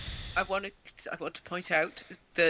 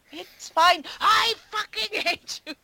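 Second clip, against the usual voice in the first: much higher and louder mock outburst; mock rage bursting out; 12 semitones higher, 6 dB louder, slower than usual. Imitating Brian Blessed blowing up at his brother, mock rage. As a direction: let the fake calm crack into fury. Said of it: "he keeps telling, um, Leonardo".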